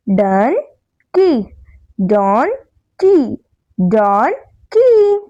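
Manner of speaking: Dunki slowly split as डन, pause, की; two separate syllables. Shown as a demonstration. Teacher has children repeat after her, Dunki slowly broken in two. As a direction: say Dunki in two drawn-out beats, don... kee. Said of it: "Some words have two syllable".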